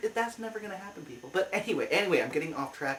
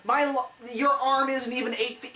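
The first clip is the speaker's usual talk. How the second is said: exasperated rant; 6 semitones higher, 3 dB louder than usual. Real, not acted. Fan ranting about the blades.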